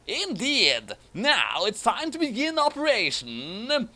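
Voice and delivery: high, rising villain proclamation; grand villain announcement. Acted. Ron Paul unveiling the plan, high grand villain. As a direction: build to the operation's name.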